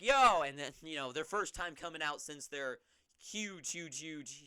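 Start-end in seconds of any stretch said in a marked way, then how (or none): 0.0-0.4 high, loud hype-man call. Retold or imitated. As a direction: call out to the crowd. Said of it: "He's like".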